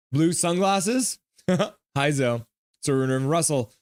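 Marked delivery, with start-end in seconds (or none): none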